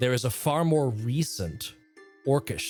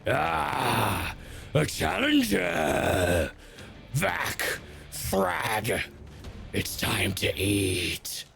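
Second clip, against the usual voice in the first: slow, lightly voiced brute; a hint of a hungry ogre; monstrous creature; much slower than usual. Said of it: "A monstrous creature".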